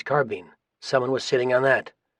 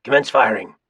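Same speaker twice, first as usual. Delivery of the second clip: louder, firm command to fire; military order; 6 dB louder than usual. A narrator's reading. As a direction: give the order firmly down a field telephone. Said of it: "A Marine spoke into a telephone".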